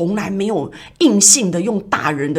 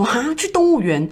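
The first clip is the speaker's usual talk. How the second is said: put-on child's question rising high in disbelief; surprised child; 8 semitones higher than usual. Little girl to her dad about skipping school, delighted surprise. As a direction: sound amazed at going to the zoo. Child told of zoo trip.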